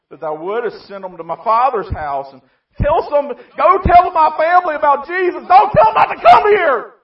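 high, loud, rising cry; rich man's desperate plea. Acted. Rich man in hell begging Abraham to warn his family, high shout.

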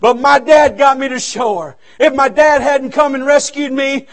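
normal talking voice

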